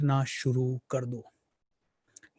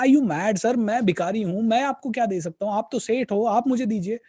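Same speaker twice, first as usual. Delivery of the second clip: indignant beggar; 6 semitones higher than usual. Retold or imitated. Beggar protesting to the seth, higher and pleading. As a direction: play the beggar baffled and pleading.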